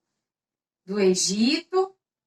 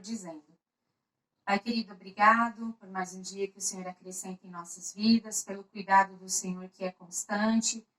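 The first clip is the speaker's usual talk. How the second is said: prayer; 3 semitones lower, 7 dB quieter than usual. Acted. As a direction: pray gently with eyes closed. Leads a prayer.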